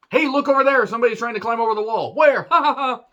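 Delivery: high, loud fake alarm, then a hammed-up laugh; mock distraction yell. Voicing Cora fooling Raquel, a hammed-up high yell. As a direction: shout to trick her, then cackle at it. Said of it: "But she's like".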